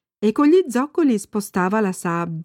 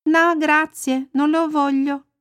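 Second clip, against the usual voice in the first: lightly voiced little girl, pitched higher; Annika; 7 semitones higher, slower than usual. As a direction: decline as a child, voice lifted. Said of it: "disse Annika e proseguì". (voce un po' acuta da bambina)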